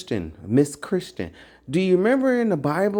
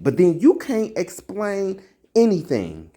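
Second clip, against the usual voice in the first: normal talking voice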